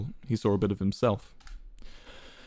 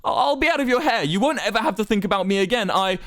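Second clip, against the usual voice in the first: high, stammering plea; desperate pleading; 11 semitones higher, 4 dB louder than usual. A narrator's reading. Wilbur promising to stay out of the way, pleading. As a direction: beg frantically, pitched way up.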